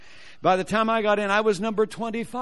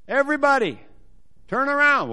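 loud, raised announcement; calling out; 7 semitones higher, 5 dB louder than usual. Retold or imitated. Man telling the waiting crowd to turn around, loud and high. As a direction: command the whole crowd's attention.

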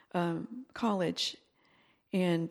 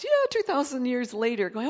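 ordinary talk

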